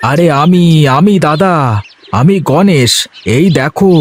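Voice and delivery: a cheerful farmhand talking to himself under the sun; young farmer Ganesh. Acted. Ganesh answers Binod.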